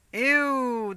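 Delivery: usual speaking voice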